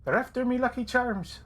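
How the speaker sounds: high whispered Irish leprechaun. Retold or imitated. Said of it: "I gotta get that voice back".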